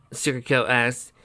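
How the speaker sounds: ordinary delivery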